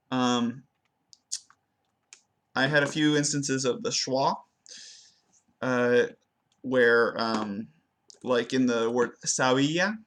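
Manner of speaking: normal talking voice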